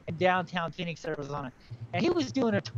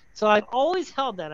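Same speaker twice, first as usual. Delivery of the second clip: ordinary talk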